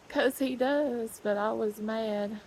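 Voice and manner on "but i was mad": normal talking voice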